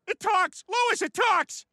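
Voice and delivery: high, loud astonished "It talks!"; amazed shout. Acted. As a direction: cry out in wonder. Astonished at talking person.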